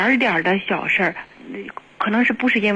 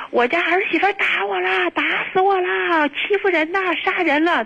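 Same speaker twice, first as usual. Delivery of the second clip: retold howling complaint; 9 semitones higher than usual. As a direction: imitate a mother-in-law crying out for all to hear. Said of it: "就咆哮".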